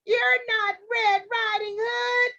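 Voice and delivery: high, slow, wavering granny voice; drawn-out shocked realisation; alarmed grandmother. A narrator's reading. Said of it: "she said".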